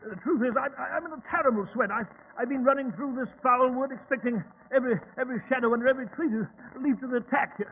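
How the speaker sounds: fast, stammering, shaken; flustered rush. Acted. Watson explains his fright after running through the wood, flustered and fast. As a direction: tumble out the excuse.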